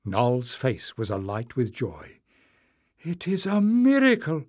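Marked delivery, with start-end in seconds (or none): 3.0-4.4 high, rising exclamation of wonder; joyful cry. A narrator's reading. Her face alight with joy, crying out. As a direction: cry out in delight, pitched well up. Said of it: "she cried".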